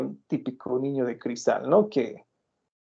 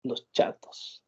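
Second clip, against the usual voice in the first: imitating doctor Castillo; 5 dB quieter, much slower than usual. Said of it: "él, por ejemplo, dice".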